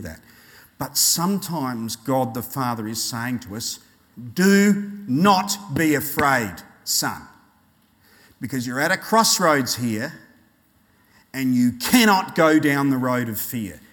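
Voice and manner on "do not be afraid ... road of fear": slow, firm fatherly command; stern. Acted. God the Father warning a son at a crossroads, stern. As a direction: lay it down word by word. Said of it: "talking to us a bit more sternly".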